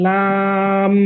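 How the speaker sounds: লাম held long, ending in a sounded m; elongated madd. Shown as a demonstration. Teacher showing mim sukun after madd, long held লাম. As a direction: stretch লাম on a level note into the m. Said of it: "মিম সুকুন".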